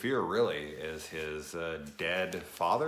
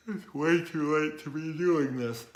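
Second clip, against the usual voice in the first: words stretched by a yawn; talking through a yawn; 10 semitones higher, 7 dB louder than usual. Real, not acted. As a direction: still mid-yawn, higher and louder. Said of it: "deal with that yawn".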